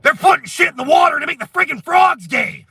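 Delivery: high, loud conspiracy rant; Alex Jones. Acted. Mimicking a crazed radio host for viewers, high ranting shout. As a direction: shout it like an outraged broadcaster. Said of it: "Remember Alex Jones?".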